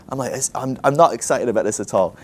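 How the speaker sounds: usual speaking voice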